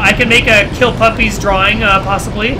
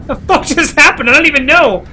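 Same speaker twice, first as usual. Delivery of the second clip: stunned; 7 semitones higher, 4 dB louder than usual. Real, not acted. Just got killed in game.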